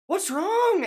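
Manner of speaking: high-pitched worried question; imitating girlfriend. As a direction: play the worried wife, light and high. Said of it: "and she's like".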